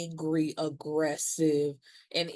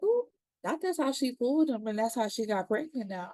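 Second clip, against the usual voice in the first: much higher, sly gossip voice; knowing ooh; 6 semitones higher than usual. Retold or imitated. Narrator reacting to the couple's ride, high and knowing. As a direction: sing out the juicy realisation. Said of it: "I was just like".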